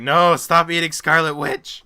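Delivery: high, loud, slow pleading yell; drawn-out comic protest; mock horrified shout. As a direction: cry out in hammed-up horror. Acted horrified cry at Hawkeye.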